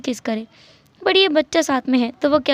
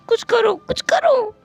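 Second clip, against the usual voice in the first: slow high wail; drawn-out whining cry; wailing plea; 9 semitones higher, slower than usual. Acted. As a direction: wail it like a crying toddler. Crying child demands help.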